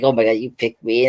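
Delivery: normal talking voice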